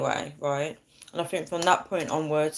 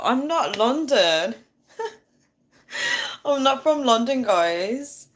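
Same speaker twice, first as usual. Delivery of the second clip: laughing denial; 7 semitones higher, slower than usual. Real, not acted. Viewers keep saying London girl.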